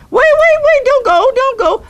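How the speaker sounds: high, loud pleading call; panicked shout to the departing cameraman; frantic calling out. Acted. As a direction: stop the cameraman from leaving.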